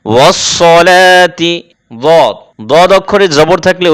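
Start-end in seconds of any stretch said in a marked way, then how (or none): 0.1-1.5 'ওয়াসসলাতি' drawn out with thick 'sso'; heavy s. Shown as a demonstration. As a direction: recite 'ওয়াসসলাতি' slowly, rounded 'sso-laa'. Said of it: "সদকে মোটা করতে গেলে".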